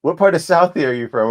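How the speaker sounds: quoted question, high and loud; retold eager question. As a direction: repeat a director's keen question to an actor. Director's question repeated in retelling.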